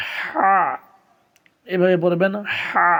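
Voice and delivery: হা squeezed from the throat; throaty ha. Shown as a demonstration. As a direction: tighten the throat on হা. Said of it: "কণ্ঠনালী চেপে পড়তে হবে".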